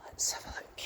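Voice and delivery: hushed whisper among graves. Real, not acted. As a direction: breathe it as a whisper. Quiet peek among graves.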